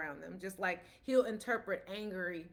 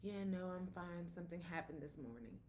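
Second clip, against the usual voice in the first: much softer, lower, downcast voice; subdued reply; 4 semitones lower, 12 dB quieter than usual. Retold or imitated. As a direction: imitate someone quietly brushing off concern. Acted downcast coworker's reply.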